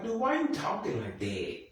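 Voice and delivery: high-pitched mock bafflement; incredulous. Viewer imagining confronting a dad who talks creepy, incredulous. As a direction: question him in a high, disbelieving voice. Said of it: "I'd be like".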